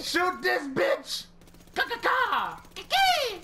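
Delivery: high, loud hyped shouting; high excited shout while shooting; hyped yell. Real, not acted. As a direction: scream it like a gleeful taunt.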